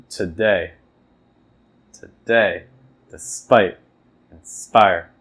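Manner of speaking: "today", "despite", "inspire" with the second syllable hit; iamb stress. Shown as a demonstration. Teacher modelling iambs: weak first beat, stress on the second. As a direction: stress the end of each word. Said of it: "weak, then stress".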